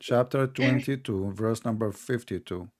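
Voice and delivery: slow, deliberate reading of the numbers; said slowly. Shown as a demonstration. Citing a Quran verse to his opponent, slowly and deliberately. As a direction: spell out each number slowly as if to a slow listener. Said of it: "Sorry, I have to say it slowly".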